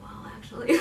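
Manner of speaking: barely-there raspy whisper; soft raspy imitated whisper; softest raspiest voice. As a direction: imitate a man on vocal rest, almost no voice. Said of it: "the softest, raspiest voice".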